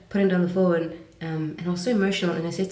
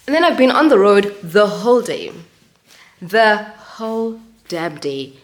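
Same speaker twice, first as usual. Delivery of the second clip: exasperated; 4 semitones higher, 10 dB louder than usual. Real, not acted. Hungry after a long day.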